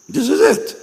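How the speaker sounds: way up high, mocking. Pastor mimicking the unbeliever who says this is it, high mock squeak.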